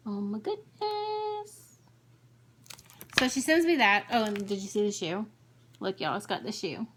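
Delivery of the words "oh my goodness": much higher, slow and soft, in awe; awed gush. Real, not acted. Stitcher admiring the dress in the chart, awed. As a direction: swoon over a pretty stitch.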